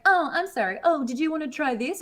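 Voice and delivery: high, put-on helpful machine voice; voice assistant. Voice assistant wrongly offering alternatives, mock-helpful. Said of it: "And it's like".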